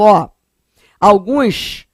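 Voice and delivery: ordinary talk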